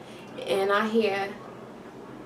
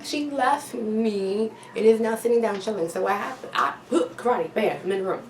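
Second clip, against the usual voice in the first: ordinary delivery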